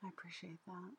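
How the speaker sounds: soft whispered thanks; whispering. Real, not acted. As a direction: breathe the thanks quietly.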